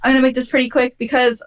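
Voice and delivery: ordinary talk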